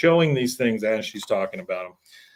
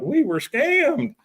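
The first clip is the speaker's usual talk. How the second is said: much higher, slow drawn-out dismay; mock shock; much slower than usual. Announcer realizing they were scammed, mock shock. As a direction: stretch out the realization in mock horror.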